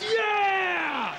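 loud, high cheering yeah; crowd cheer. Retold or imitated.

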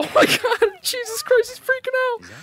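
high, squealing laughter through the words; cracking up, barely getting it out; laughing hysterically. Real, not acted. Shovel fight goes absurd.

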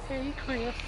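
high cartoon voice. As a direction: squeak it out very high. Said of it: "I have a friend that can do that as well".

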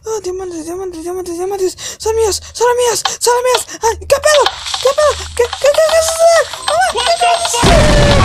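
muy agudo y fuerte, atropellado al final; codicia y alarma. Pretend. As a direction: pasa de la codicia al pánico. Diamantes, luego alarma. (agudo y fuerte, de la codicia por los diamantes al pánico)

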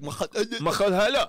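slow, throaty fake Arabic; invented guttural Arabic-sounding word; mock Arabic. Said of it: "that same kinda Arabic".

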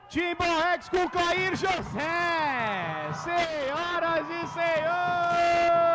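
slow, loud stadium announcer; announcer call. Real, not acted. Announcer introduces semifinal.

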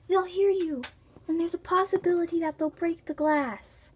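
ordinary delivery

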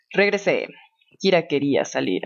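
normal talking voice

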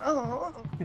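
mock moaning. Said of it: "she's going".

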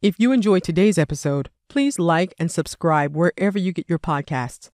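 usual speaking voice

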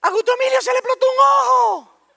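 put-on very high, loud kid-sister shriek; panicked yelling. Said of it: "y vocea". (grito agudo de hermanita alarmada)

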